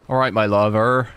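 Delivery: a West Country Bristol greeting; Bristol accent. Retold or imitated. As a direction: say it like a Bristol local. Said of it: "I sound like I'm from Bristol".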